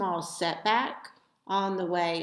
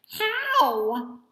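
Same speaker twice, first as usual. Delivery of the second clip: pleading; 8 semitones higher, 5 dB louder than usual. A narrator's reading. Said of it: "they pleaded".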